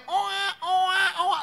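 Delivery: short high mock baby wails; high, evenly repeated baby cry; Chinese newborn cry, imitated. Shown as a demonstration. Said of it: "Anak Cina kalau lahir".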